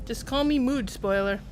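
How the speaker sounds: ordinary talk, nothing marked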